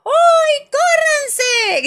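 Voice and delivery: chillido agudísimo y fuerte; grito divertido. A narrator's reading. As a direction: grita para que se aparten. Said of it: "grita mamá Pig divertida". (gritando muy agudo, en plena bajada)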